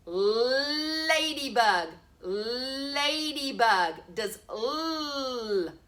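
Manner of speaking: ladybug stretched slow and deliberate; slow sounded word. Shown as a demonstration. Teacher to pupils, slow deliberate ladybug. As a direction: draw ladybug out slowly. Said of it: "Ladybug makes the L sound".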